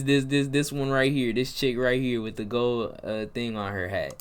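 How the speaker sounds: ordinary delivery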